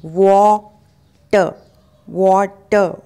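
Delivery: वाटर as slow, loud WAW-tuh; correct pronunciation. Shown as a demonstration. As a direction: stretch वाटर into two parts with rounded vowel, silent R. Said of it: "आपको बोलना है वॉ". (वाटर का सही उच्चारण, वॉ-ट)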